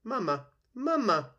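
put-on very high, loud baby-bird cries; peeping gosling. A narrator's reading. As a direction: peep it out like a hungry hatchling calling its mother. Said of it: "peeped the gosling".

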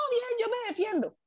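imitating a cheerful high bluff; mock confident applicant. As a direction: play the overconfident applicant claiming decent English. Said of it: "Y uno siempre dice".

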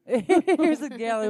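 laughing. Real, not acted.

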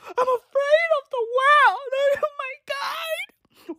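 high, panicky, half-crying mock whine; mock triggered snowflake. Mocking Gen Z over a post, in a high, weepy, panicky whine. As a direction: parody a hysterical anxious kid, way up high.

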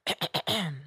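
exaggerated throat clearing before repeating; pointed throat clear. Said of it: "Let me clear my throat".

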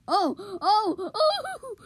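high laughing whoops; excited laughing shout. Real, not acted. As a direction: burst out laughing in surprise. Excited over a play.

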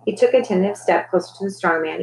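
usual speaking voice

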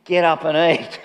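firmer, commanding get up and eat. Retold or imitated. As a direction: order him up with authority. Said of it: "a bit more authority in it".

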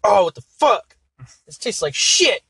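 high, loud mock-revolted yell; disgusted outburst. Little guy complaining after biting the apple, disgusted yell. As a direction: blurt it out as if spitting out a bad bite.

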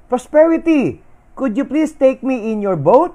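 high, loud call across the water; Love begging a passing boat, shouting high; pleading shout. A narrator's reading. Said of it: "Love shouted".

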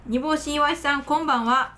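strong Kansai intonation while reading; Kansai accent. Real, not acted. Host reading a listener's radio name, in Kansai accent. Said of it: "バリバリ関西弁で喋ってもうた".

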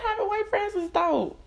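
high-pitched squeal of delight; gushing in a squeaky, delighted voice. Acted. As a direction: gush it up high like a giddy fan. Gushing over her friends.

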